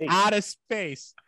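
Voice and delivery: slow, drawn-out Boston accent. Shown as a demonstration. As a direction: stretch it out like a Bostonian. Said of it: "my Boston accent almost kicked in there".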